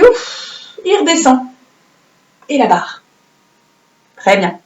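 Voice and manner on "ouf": breathy puff of relief. Shown as a demonstration. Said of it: "Et puis".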